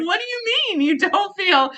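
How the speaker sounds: incredulous, hammed-up shock; horrified disbelief. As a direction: ask it like he's from another planet. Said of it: "I was so horrified".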